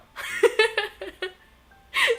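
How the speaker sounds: loud, high giggle; amused laugh. Real, not acted. Laughing about mom keeping the knives, loud high giggle.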